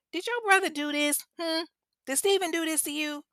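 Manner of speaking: high, pointed interrogation; accusing mother. Retold or imitated. Mother asking her son about his brother, suspicious and probing. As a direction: imitate a scary mom probing for a culprit. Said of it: "with that scary ass mama stare".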